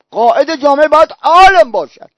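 long, loud swell on «عالم»; stress on عالم. Real, not acted. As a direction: hit «عالم» hard and hold it. Preacher repeats the key word emphatically.

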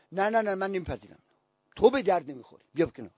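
low, soft refusal; quiet dismissal. Retold or imitated. Imam dismissing his appointee from office, quiet and low. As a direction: turn them down softly, without raising the voice.

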